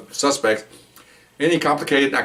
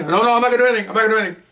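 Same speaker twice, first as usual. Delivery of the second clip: much higher, louder and fast, scrambling to calm things; panicked pleading; 11 semitones higher, 3 dB louder, much faster than usual. Retold or imitated. Suspect facing a drawn gun, panicked high pleading. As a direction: babble reassurances to stop him from shooting. Suspect denies threat at gunpoint.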